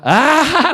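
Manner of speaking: high, loud drawn-out 'ah', acted; loud put-on 'ah'. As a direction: let out a big high mock ah. Rehearses happy compliant reaction.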